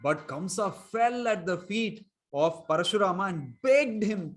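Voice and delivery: ordinary talk, nothing marked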